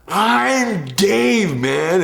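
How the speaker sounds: high, loud whiny stoner. Retold or imitated. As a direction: plead like a stoned Dave. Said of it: "dumb stoner voice".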